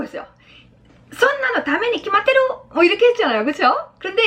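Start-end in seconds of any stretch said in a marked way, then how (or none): 1.1-2.4 high, flustered schoolgirl-like exclamation; acting out sudden alarm; startled outburst. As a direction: exclaim it high, as if caught off guard. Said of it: "아빠가 갑자기 화들짝 놀래면서".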